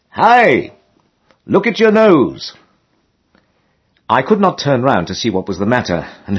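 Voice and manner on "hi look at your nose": high, carrying call across the lock. A narrator's reading. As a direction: shout a warning from behind. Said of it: "I heard someone behind me call out".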